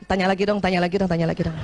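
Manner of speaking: low, quick pleading chant; inner voice. Retold or imitated. Wife secretly begging to be asked, low hurried inner voice. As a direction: rattle off a secret plea, pitched low. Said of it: "Dalam hati masih juga".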